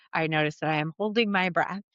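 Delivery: usual speaking voice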